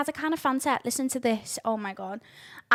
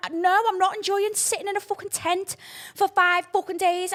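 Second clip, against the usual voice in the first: ordinary delivery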